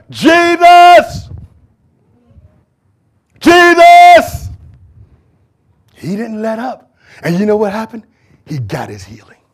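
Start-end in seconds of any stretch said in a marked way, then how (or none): 0.1-1.3 loud high cry of the name; shouting out. Acted. Blind man shouting for Jesus over the crowd, loud cry. 3.4-4.5 loud high cry of the name; shouting out. Acted. Blind man shouting for Jesus over the crowd, loud cry.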